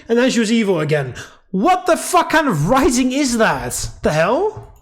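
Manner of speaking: real, loud high-pitched outburst; exasperated rant. Real, not acted. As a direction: rant in baffled outrage at the bad writing. Player rants about the game's writing.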